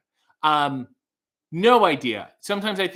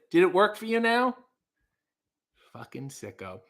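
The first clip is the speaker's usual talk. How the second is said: ordinary talk, nothing marked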